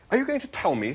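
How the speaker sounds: high-pitched indignant challenge; snapping. A narrator's reading. Arthur challenging the disapproving animal, snapping. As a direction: snap it high, affronted. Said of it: "snapped Arthur".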